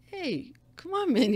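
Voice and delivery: high, slow coaxing; slow, high plea to the neighbour; mock plea. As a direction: talk him down lightly. Pleading with the angry man.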